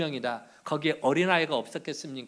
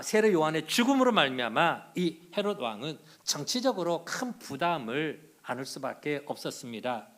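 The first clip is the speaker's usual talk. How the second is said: normal talking voice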